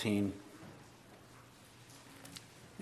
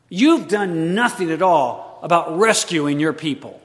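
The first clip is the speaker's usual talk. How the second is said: rising, louder, accusing plea to God; heated prayer; 6 semitones higher, 8 dB louder than usual. Acted. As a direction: argue with God, pressing harder each line. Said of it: "prayers ever get a little heated".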